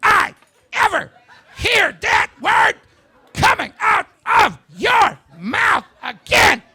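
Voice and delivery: high, loud, slow, hammered-out mom rant; loud, high, slow mock yell of a furious mother. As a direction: scream it like an enraged mother in a Kmart. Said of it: "She was just".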